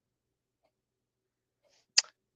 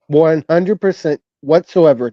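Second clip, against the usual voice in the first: usual speaking voice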